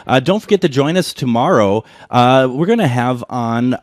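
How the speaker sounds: loud welcoming radio host; ominous late night radio. Acted. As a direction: welcome listeners back on air. Host returns from break.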